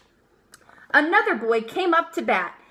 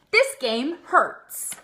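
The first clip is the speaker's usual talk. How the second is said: slow complaint; much slower than usual. Acted. Amelia hurt by the ball.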